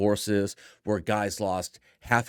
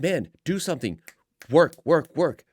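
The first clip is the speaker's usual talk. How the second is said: nagging shout, louder on the work words; mock harpy yell; 6 semitones higher, 6 dB louder than usual. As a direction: bark orders like a nagging partner. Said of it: "this screaming harpy in my ear".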